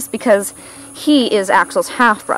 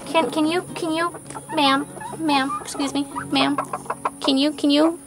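high, sweet coaxing that climbs higher; coaxing pet talk; 9 semitones higher than usual. Real, not acted. Owner coaxing a dust-bathing silkie into view, high and sweet.

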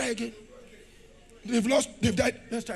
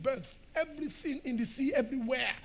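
ordinary talk

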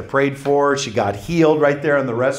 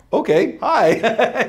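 high, drawn-out awkward hello; slow mock greeting from stunned strangers; imitating shocked strangers; 7 semitones higher, much slower than usual. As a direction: stretch it out high, taken aback. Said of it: "so they were shocked".

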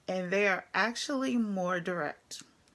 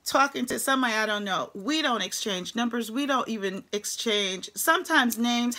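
ordinary talk

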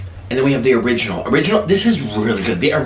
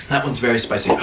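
ordinary delivery

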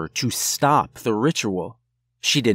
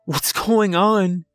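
high, loud, slow panicked plea; 7 semitones higher, 4 dB louder, slower than usual. A narrator's reading. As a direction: beg for an answer in rising panic. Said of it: "she sounded on the verge of panic".

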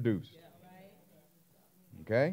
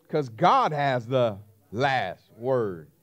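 slow, weighty; 3 semitones lower, 4 dB louder, much slower than usual. Acted. Sermon climax line.

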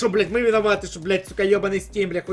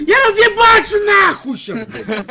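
drawn-out, much higher furious shout; raging gamer; 9 semitones higher, 8 dB louder, slower than usual. Real, not acted. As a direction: explode in rage at the game. Fails the level again.

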